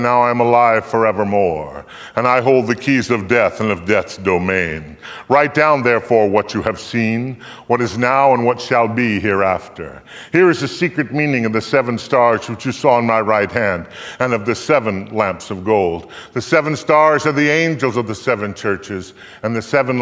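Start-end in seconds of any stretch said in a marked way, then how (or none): none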